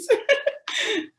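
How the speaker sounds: high, loud burst of laughter; real laugh. Real, not acted. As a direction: laugh out loud at running over time. End of her ten minutes. (éclat de rire aigu)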